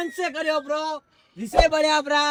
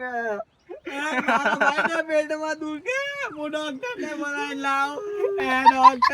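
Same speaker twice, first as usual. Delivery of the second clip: slow, sobbing wail to the doctor; hammed-up crying; 2 semitones higher, slower than usual. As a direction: overact the tears, drawn out and weepy. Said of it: "રોવાનું ભાઈ".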